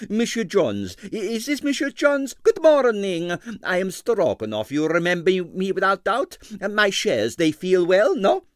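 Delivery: put-on high, heavily accented excitable impresario; Stroganoff's Russian accent. A narrator's reading. Ballet impresario to the detective, in a Russian accent.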